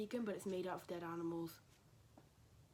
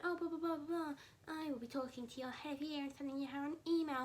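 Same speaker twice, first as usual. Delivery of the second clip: high-pitched imitation of a nagging teacher; mock scolding with babbled blahs; 7 semitones higher than usual. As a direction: mimic a telling-off, up high. Mimicking the same teacher's threats.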